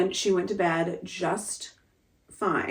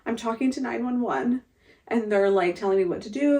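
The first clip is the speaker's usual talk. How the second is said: usual speaking voice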